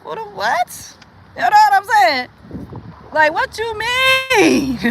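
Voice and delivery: high, slow, stretched "what you mean"; disbelief. Real, not acted. Incredulous outburst.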